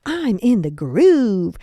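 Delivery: slow, drawn-out self-satisfied voice; smug in the groove. Retold or imitated. Mocking someone overworking, slow smug voice. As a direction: savor each word like you're on a roll. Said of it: "Don't sit there and be like".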